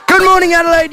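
big, loud, high breakfast-show hello; booming DJ good morning; radio host greeting. Acted. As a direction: throw it out to the whole city.

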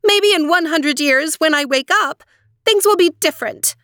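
high, resolute princess; firm voice. A narrator's reading. Aurora declaring her decision to three aunts, firm. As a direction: stand your ground, emphatic and high. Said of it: "in a firm voice".

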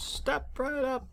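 high, hammed-up sideshow barker pitch; carnival barker. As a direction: call out like a fairground barker, high and showy. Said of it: "it sounds like bad Carnival Barker".

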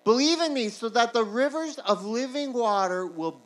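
loud, high start easing down slowly; proclaimed scripture. Acted. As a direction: proclaim it, stretching the phrases out. Preacher proclaims Jesus's shouted words.

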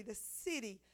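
normal talking voice

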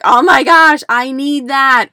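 loud, high, gushing "oh my gosh"; excited gush. Retold or imitated. Client hearing about a declutter coach, loud excited gush. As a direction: gush like you've found a lifesaver. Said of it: "Usually people are like".

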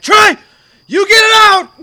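very high, full-blast yell; screamed command. Retold or imitated. Turning to Trey for help, screamed command. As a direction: scream the order at your friend. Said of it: "I was just screaming commands".

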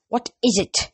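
cold, disdainful question; a hint of teacher's contempt for the boy; contemptuous. A narrator's reading. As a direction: let him hear you detest him. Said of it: "His tone made it clear that he detested Grover".

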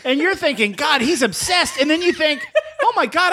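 high, laughing while talking. Real, not acted. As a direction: giggle through the words. Talks through laughter.

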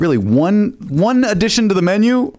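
high, incredulous pleading; mock exasperation. Customer arguing with a burger chain for a chicken sandwich, exasperated. Acting out his exasperated email argument.

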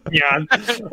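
high cutesy nyan; cat nyan. Shown as a demonstration. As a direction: say it like a cartoon cat. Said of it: "You just say nyan".

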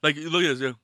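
ordinary delivery

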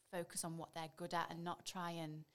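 ordinary delivery

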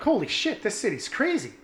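high, amazed holy shit; a high, awed exclamation. Retold or imitated. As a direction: gush with wide-eyed disbelief. Amazed at the party.

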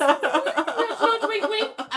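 high giggly nudge nudge wink wink; cheeky laughing. Acted. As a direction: tease it through a laugh. Hinting at new profile pictures.

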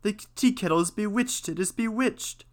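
high-pitched alarmed exclamation; crying out. A narrator's reading. Novice reports the bewitched kettle, crying out. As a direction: read as an astonished cry, voice lifted high. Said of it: "he cried".

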